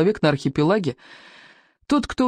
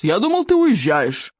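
drawn-out, higher jibe; stern; 4 semitones higher than usual. A narrator's reading. Father tells his son he should be leaving, stern. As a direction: stretch it into a stern reproach. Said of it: "сурово спросил отец".

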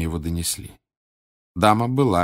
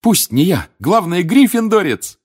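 loud, high, thrilled cheer; elated girl's exclamation; jubilant; 10 semitones higher, 6 dB louder than usual. Acted. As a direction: celebrate at full voice. Teammate rejoices, hugging the hero.

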